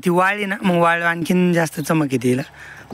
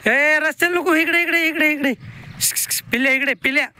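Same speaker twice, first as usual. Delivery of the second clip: very high call to pull the calf back from the road; urgent call; 12 semitones higher than usual. Real, not acted. Calf heading to road, calling back.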